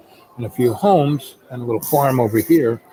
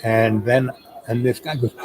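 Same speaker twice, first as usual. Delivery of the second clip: usual speaking voice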